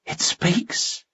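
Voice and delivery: a knight startled that the monster talks, gasped whisper. A narrator's reading. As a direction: gasp it in shocked disbelief. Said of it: "gasped Guy".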